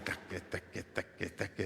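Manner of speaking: a soft, half-laughing "taque, taque" like a drumbeat; laughing beat imitation. Preacher mimics the party music for the congregation, softly laughing. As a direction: chuckle out the party rhythm under your breath.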